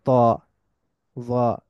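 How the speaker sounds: ত, ওয়া stretched very slowly as heavy Arabic letters; heavy letter demo. Shown as a demonstration. Teacher listing the heavy letters, very slow. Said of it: "যেমন দাদ".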